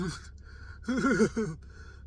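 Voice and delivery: high wobbling babble, barely able to talk; imitated wordless mumbling of someone panicking; wordless slurred noises. Patient unable to talk to nurse, slurred wobbling noises. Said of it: "I can barely talk".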